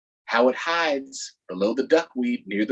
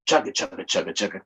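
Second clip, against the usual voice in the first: motor noise; 8 semitones lower, much faster than usual. Acted. Starting the boat motor.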